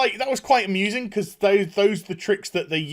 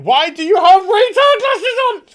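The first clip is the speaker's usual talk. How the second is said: much higher, louder scornful voice; shrill mocking girl; 11 dB louder than usual. Stepmom mocking the goggles, high scornful voice. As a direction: jeer like a mean teen. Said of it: "she's like".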